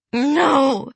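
high, loud anguished wail; sobbing cry. Acted. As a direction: scream it through tears. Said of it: "she sobbed".

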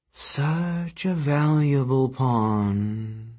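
very slow, eerie soothing goddess. Acted. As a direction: croon it like a sleeping earth goddess. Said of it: "she said soothingly".

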